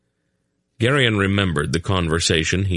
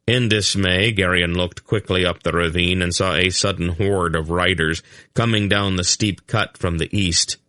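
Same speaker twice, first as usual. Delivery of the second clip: ordinary delivery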